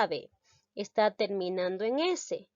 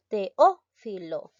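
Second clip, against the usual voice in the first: teófilo very slowly, with Ó punched out loud; teacher's demo of te-Ó-filo; stressed syllable demonstration; 4 dB louder, much slower than usual. Shown as a demonstration. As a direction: stretch teófilo, hitting the second syllable Ó. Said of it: "reconozco la sílaba tónica".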